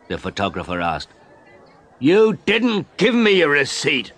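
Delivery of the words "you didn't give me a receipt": a high, loud shout of protest; angry shout. A narrator's reading. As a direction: yell it up high in outrage. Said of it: "I shouted".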